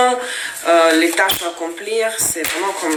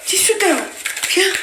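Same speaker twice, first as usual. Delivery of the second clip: calling the cat; 11 semitones higher than usual. Real, not acted.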